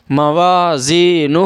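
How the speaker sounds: slow "موازینہ", long aa, ee and final oo; drawn-out recitation. Shown as a demonstration. Quran teacher modelling the word, "موازینہ" slowly stretched. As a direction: hold each long vowel in "موازینہ". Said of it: "ایسے پڑھیں گے".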